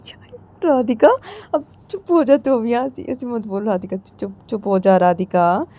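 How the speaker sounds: calm her sister down firmly; hushing elder sister. Acted. Elder sister hushing Radhika, firm and slowing.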